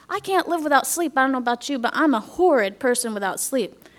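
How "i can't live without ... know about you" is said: usual speaking voice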